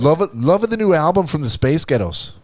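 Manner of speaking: put-on high, loud Scottish accent; mock Scottish fan raving about the album. Host joking about the Spice Girls album, Scottish accent. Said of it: "approximates the Scottish accent".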